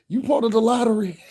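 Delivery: high, loud mock disbelief breaking into laughter; high-pitched comic jab; laughing incredulous retort. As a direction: crack up while asking it. Acted comeback, breaking into laughter.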